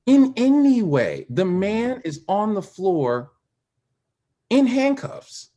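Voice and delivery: high, loud, slow protest; pleading outrage at the police killing; indignant. Real, not acted. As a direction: lay out the injustice with rising outrage. Outrage over George Floyd's killing.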